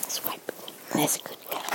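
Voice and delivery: very soft whisper. Real, not acted. Mother wiping baby's dribbles, whispering. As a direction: hush it while wiping her face.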